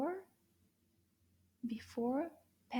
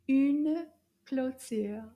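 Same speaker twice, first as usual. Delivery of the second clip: very slow, Une a little louder; slow French repeat; 5 dB louder, much slower than usual. Shown as a demonstration. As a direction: model it slowly once more. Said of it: "Féminin".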